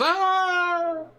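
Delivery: sudden high-pitched startled yelp. Retold or imitated. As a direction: yelp like a panicked bridge officer. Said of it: "and somebody goes".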